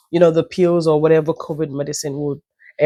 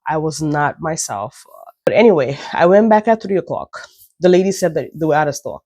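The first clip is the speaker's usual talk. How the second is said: ordinary delivery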